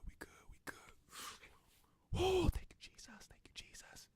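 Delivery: hushed, breathless whisper; whispering to himself. Retold or imitated. Plumber alone with the cash, whispering in shock. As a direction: whisper so nobody outside hears.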